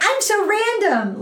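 high mock "random" girl voice; mocking a Reddit girl who thinks she is so random; quirky girl. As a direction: parody a self-consciously quirky girl. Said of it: "girls you read on Reddit who's like".

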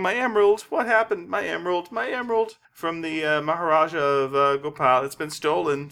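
lightly mocked high wail over a lost emerald; hammed-up diva in distress; frantic diva. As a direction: cry out like a panicking opera singer. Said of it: "and is going".

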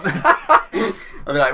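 laughing. Real, not acted. Laughing mid story.

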